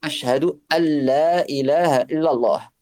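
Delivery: "Ashhadu an la ilaha illallah" with "an la" fused into a doubled l; best pronunciation. Shown as a demonstration. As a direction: merge the n into the lam, "anla" as "alla". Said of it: "C'est ça la meilleure prononciation". ("an la" fusionné en "alla", lam doublé)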